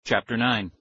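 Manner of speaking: plain reading voice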